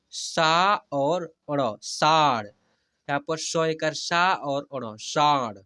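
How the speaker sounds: very slow teacher's demo, long stretched vowels; slow spelling-out. Shown as a demonstration. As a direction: spell each letter slowly, then draw out the whole word. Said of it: "ऐसे". (धीरे-धीरे हिज्जे करके, स्वर खींचकर)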